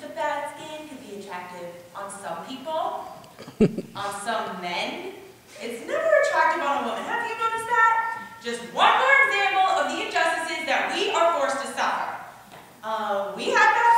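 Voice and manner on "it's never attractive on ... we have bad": higher, louder outrage; indignant rant. Real, not acted. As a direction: fume about the unfairness. Outraged rant about injustice.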